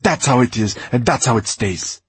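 snapping furiously. A narrator's reading. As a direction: spit the words at him. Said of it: "Roxanne snapped at him".

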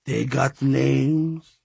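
slow, raspy skeleton voice; deep raspy voice. A narrator's reading. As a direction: drag the words out, rasping. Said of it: "he asked her".

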